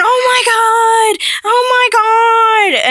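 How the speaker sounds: very high, loud and drawn out, slow; imitating grandpa's excited cry. Grandpa finally recognizing his granddaughter, imitated as a high drawn-out shriek. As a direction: squeal it like a stunned old man suddenly remembering.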